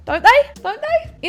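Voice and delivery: squeaky, insistent questioning; high-pitched doubt. Acted. Quiz player seeking backup after a miss, high mock doubt. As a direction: plead for agreement in a very high voice.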